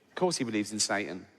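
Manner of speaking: usual speaking voice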